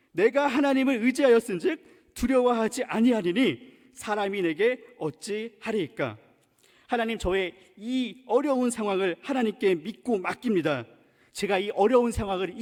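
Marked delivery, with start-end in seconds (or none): none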